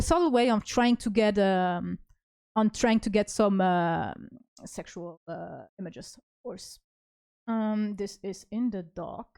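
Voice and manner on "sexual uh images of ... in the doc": much softer, trailing off sheepishly; embarrassed mumble while reading; quiet mumble. Real, not acted. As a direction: say it half to yourself. Embarrassed aside.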